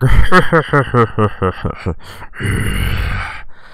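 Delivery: long imitated rattling growl-laugh; taunting growly laugh. Creature outside the door, imitated taunting growl-laugh. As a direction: growl and chuckle tauntingly, drawn out. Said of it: "like, taunting".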